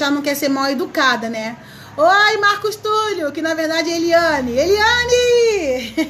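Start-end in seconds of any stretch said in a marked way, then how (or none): 4.5-6.0 amused shout of a name, half laughing; laughing exclamation. Real, not acted. Host laughing as she calls out to viewer Eliane. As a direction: cry the name out through a laugh.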